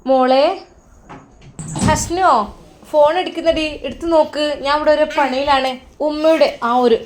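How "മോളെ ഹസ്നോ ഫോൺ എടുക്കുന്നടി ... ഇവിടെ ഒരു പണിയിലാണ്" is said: mother shouting to daughter to get the phone; calling out. A narrator's reading. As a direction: call her from the kitchen. Mother calling from another room.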